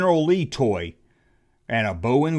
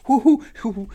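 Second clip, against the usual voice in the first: mock excited whoop; 8 semitones higher than usual. Excited whooping about Milano.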